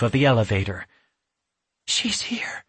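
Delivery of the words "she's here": soft, breathless whisper. A narrator's reading. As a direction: whisper in fear. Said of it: "Yasmin whispered".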